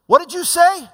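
high, loud surprised call; calling out in surprise. Retold or imitated. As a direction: shout the question across the factory floor. Worker calls out across factory.

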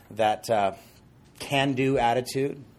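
normal talking voice

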